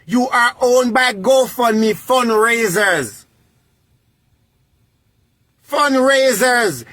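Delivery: "owned" and "Fundraisers" drawn out slowly; slow mocking emphasis. Scolding the broke woman about GoFundMe, slow and mocking. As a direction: spell it out slowly to mock her.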